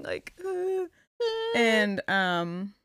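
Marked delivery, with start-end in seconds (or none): 0.0-0.9 mock dying whimper.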